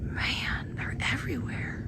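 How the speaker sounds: hushed whisper, barely voiced; frightened whisper in the dark; scared whisper. Real, not acted. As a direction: whisper in awe and fear. Alone in dark woods, scared.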